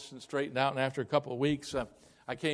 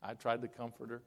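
ordinary talk, nothing marked

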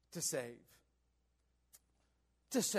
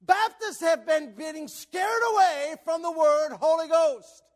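loud, high pulpit shout; fervent, raised-voice exhortation; full-force preaching; 10 semitones higher, 10 dB louder than usual. Real, not acted. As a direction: hammer the point home to the congregation.

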